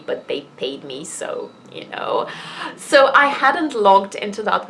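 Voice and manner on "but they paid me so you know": hushed little admission; whispered aside. Real, not acted. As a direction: drop to a whisper for the money bit. Conspiratorial aside about the money.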